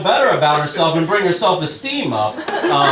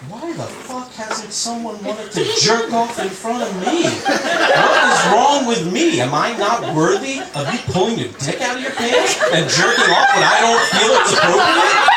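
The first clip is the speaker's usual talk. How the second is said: pissed off; 6 semitones higher than usual. Retold or imitated. Women left out of the Me Too talk, mock pissed off. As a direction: complain in a hammed-up, rising outrage. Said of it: "they're getting pissed off".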